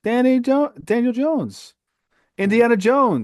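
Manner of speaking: high excited name calling; excited hype. Real, not acted. Hyping his player loudly.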